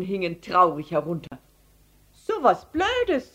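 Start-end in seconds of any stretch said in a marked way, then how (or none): 2.1-3.3 high-voiced boy, slow scoffing; dismissive scoff. A narrator's reading. Paul reacting to the elephant's story, dismissive scoff. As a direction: wave it away in a high voice.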